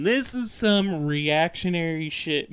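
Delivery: usual speaking voice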